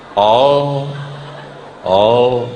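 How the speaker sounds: ordinary talk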